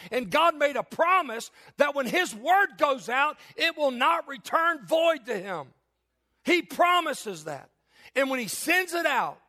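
high, loud, emphatic sermon; a preacher exalting God's Word; preaching at full force. Acted. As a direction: drive the point home from the pulpit.

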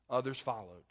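ordinary delivery

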